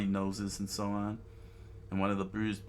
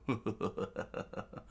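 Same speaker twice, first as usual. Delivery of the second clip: soft, creepy villain laugh; demented chuckle; 6 dB quieter than usual. A narrator's reading. Demented bandit closing in on the girl, chuckling. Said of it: "the demented one popped up".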